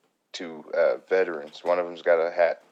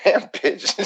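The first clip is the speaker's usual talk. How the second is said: much higher, louder mock shock; 8 dB louder than usual. Waiter retelling a reaction to the rude customer, much higher and louder. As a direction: blurt it out in exaggerated disbelief. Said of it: "I'm like".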